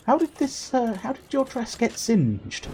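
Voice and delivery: high-pitched put-on store clerk; store assistant. Clerk asks customer about singed dress, mock-concerned. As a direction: question it in a high, drawn-out mock voice. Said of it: "they're gonna ask you".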